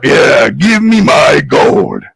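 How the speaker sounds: slow, booming demand; giant. Acted. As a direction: draw it out as a menacing giant. Giant demands his gold.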